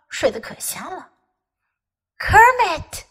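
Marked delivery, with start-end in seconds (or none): 2.2-3.0 higher pleading call of a name; begging call. A narrator's reading. Little bear begging his sleeping friend to wake. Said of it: "Fuzzy begged a little louder".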